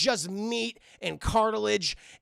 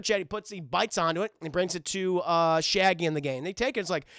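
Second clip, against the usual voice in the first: ordinary talk, nothing marked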